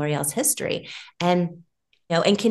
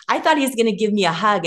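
ordinary talk, nothing marked

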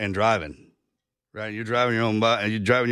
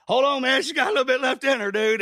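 much higher, hammed-up pleading to keep going; coaxing the engine; 12 semitones higher than usual. Runner pleading with his overheated body, mock-high coaxing. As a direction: egg on a smoking car that's nearly done.

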